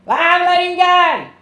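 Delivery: loud, high, drawn-out yell. Acted. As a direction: shout it from the back of the cart. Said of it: "He yells at, yells from the back".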